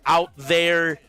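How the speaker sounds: esports caster. Real, not acted. Caster call after the win.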